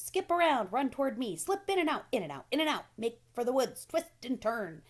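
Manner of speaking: loud, high, urgent goose; a bossy goose barking orders; goose crying out. A narrator's reading. Goose shouting orders to Wilbur, loud and high. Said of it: "cried the goose".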